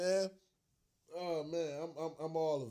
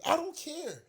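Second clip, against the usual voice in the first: defiant; 11 semitones higher, 3 dB louder, slower than usual. Real, not acted. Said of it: "How about that?".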